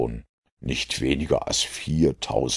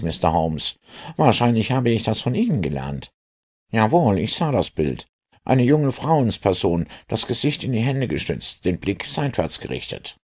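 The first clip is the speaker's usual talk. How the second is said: high-pitched inspector voice; self-assured inspector; 9 semitones higher than usual. Acted. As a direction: play the sharp-eyed inspector, pitched well up. Inspector's figure voice in story. (selbstbewusster Inspektor mit hoher Stimme)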